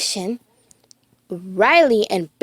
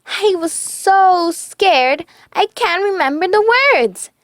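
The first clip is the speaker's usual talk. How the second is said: scared; 8 semitones higher, 3 dB louder than usual. A narrator's reading. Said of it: "said Bing Bong".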